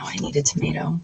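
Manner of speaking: whispered, almost no voice. Shown as a demonstration. Host whispering her new catchphrase to viewers. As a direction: breathe it like a secret. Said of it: "write that on there".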